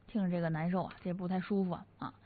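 low, soft, pained aside; real heavy-hearted unease. Real, not acted. Host reacting to a rescuer's death, saddened and subdued. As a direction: let the voice sink quietly with sorrow.

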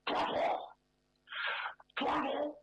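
put-on strangled call of an old butler; choked old-butler imitation. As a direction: pinch your throat and call like the butler. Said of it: "我得学这老管家". (掐着嗓子学老管家喊)

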